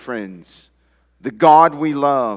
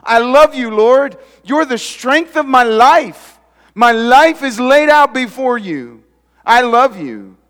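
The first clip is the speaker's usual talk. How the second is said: higher and much louder, crying out to God; passionate prayer; 8 semitones higher, 8 dB louder than usual. Acted. Pastor voicing David's love prayer to God, fervent and loud. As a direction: pour it out like a devoted psalmist. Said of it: "the way David began this psalm".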